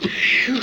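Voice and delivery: tired puff of breath. Acted. Tired from cranking a generator.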